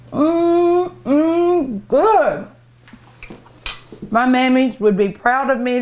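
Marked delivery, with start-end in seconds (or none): 0.1-2.5 high, drawn-out yummy hums; delighted savoring. Real, not acted. Cook tasting her own dessert, delighted savoring. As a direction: hum with relish, pitched way up.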